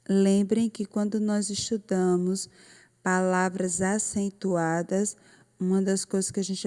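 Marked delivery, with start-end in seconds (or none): none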